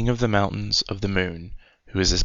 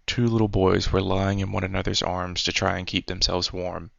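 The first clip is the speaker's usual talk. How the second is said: ordinary delivery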